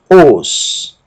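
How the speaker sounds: ordinary talk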